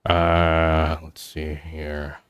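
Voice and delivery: slow, soft, drawn-out thinking aloud; a hint of a dad mulling the menu, slow and soft; lightly voiced pondering dad. Dad customer mulling his order for the waiter, slow and soft.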